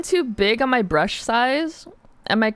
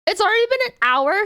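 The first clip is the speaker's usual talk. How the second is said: surprised; 11 semitones higher, 4 dB louder, faster than usual. Real, not acted. Realizes stream time passed.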